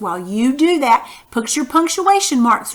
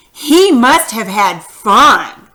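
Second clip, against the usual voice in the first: loud, slow, emphatic "He must have had fun"; exclamation reading; 7 dB louder, much slower than usual. Shown as a demonstration. Teacher rereading to show an exclamation mark, loud drawn-out emphasis on "fun". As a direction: punch each word and stretch "fun". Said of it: "did you hear how I read that".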